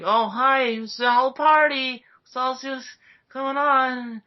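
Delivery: high-pitched, put-on breezy voice; hammed-up innocent surprise, high throughout; mock cheery greeting. Pair stumble in on the scene, mock cheerful. Acts out Rey and Finn walking in.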